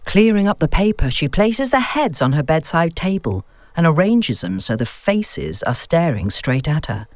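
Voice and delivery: ordinary delivery, nothing marked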